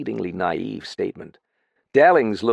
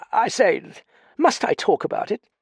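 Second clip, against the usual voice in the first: put-on flustered young toff; 13 semitones higher, 3 dB louder than usual. Dallings reluctant.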